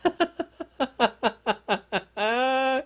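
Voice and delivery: long, high giggling laugh. Real, not acted. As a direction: crack up at your own routine. Laughs at own routine.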